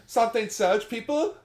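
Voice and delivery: hammy dubbed hero cry. Pretend. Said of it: "if she was dubbed".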